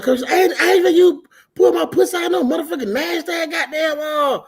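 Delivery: high-pitched mock indignation; retold indignant refusal. Woman refuses to pee at the gas station, indignant. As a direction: imitate her disgusted protest.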